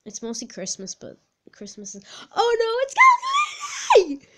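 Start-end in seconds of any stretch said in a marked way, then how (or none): 2.3-4.3 high, loud, dramatic dread; frantic string of high, loud cries; playful panic. Real, not acted. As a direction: panic as the result is about to appear. Panics while the result calculates.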